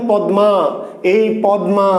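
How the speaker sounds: "পদ্মা" as pad-ma; written form. Shown as a demonstration. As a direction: sound the m clearly in "পদ্মা". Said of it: "আমরা যে শব্দটা লিখলাম".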